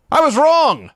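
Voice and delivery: high, loud, hammed-up regret; over-the-top mea culpa; dramatic confession.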